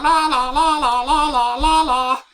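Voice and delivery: high sing-song leprechaun la la; leprechaun la-la sound. Acted. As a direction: chant la la like a leprechaun. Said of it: "I think that's the sound that leprechauns make".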